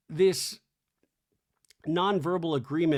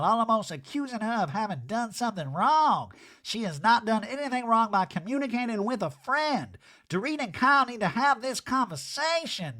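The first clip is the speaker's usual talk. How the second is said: high mock Erica voice; lightly voiced Erica lecturing; 6 semitones higher than usual. Recapping Erica's talking head in a high mocking voice.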